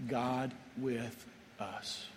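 each word of "God with us" laid down slowly and softly, God stressed; hushed, drawn-out "God with us"; slow solemn emphasis. Shown as a demonstration. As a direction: say "God with us" very slowly and quietly, pausing on God. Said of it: "And write this down".

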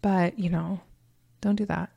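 soft, lightly voiced son's brush-off; a hint of an awkward kid; imitating sly son. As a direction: gently fend off Mom, as her son. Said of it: "he'll just slyly move it away".

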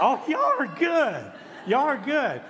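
high, loud, drawn-out delight; delighted praise. Real, not acted. Preacher praising congregation for answering the call, delighted. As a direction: cheer them for knowing the response.

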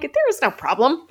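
high, indignant "there is no problem"; exasperated protest. Retold or imitated. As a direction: protest in disbelief. Retold own exasperated reply.

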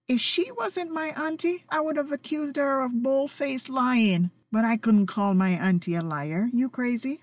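usual speaking voice

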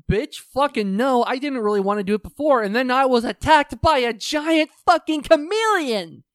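loud, high retold outrage; hammed-up angry refusal, climbing at the end; indignant refusal. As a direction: build to an exasperated mock yell. Acted indignant refusal in story.

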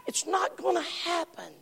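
normal talking voice

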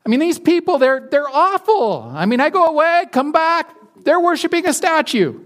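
a put-upon leader whining to his boss; whiny Moses. Retold or imitated. Moses griping to God about his people, high whiny voice. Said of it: "and he's like".

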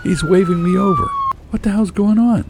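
high-pitched, agitated yell. A narrator's reading. Husband waved over by police, high agitated yelling. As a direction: call out in alarm, pitched far up. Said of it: "I yelled".

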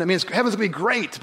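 normal talking voice

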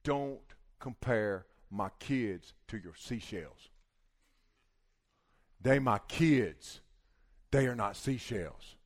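slow, weighted words with long pauses; deliberate warning. Retold or imitated. Wife standing up to the judge, slow firm warning. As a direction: spell it out with quiet, firm menace.

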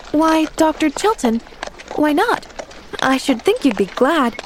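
high, innocent questioning; puzzled surprise. A narrator's reading. Girl questions the doctor's refusal, puzzled and surprised. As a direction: ask in childlike bewilderment.

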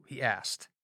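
plain reading voice

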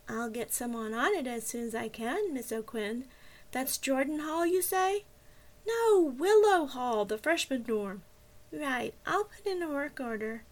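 lightly voiced bored operator; bored dispatcher. A narrator's reading. The dispatcher stalls Jenny about the hot water, bored. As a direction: drag through it, uninterested. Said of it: "The dispatcher sounded incredibly bored".

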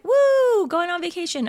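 high, loud excited cheer; excited whoop. Retold or imitated. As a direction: whoop it like vacation just started. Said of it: "it could be like".

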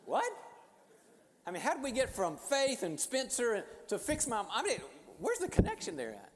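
high, loud, incredulous outburst; hammed-up bewilderment, pitch leaping way up; baffled disbelief. Mentor asked to fix her mother, utterly baffled. Baffled reaction to sudden odd request.

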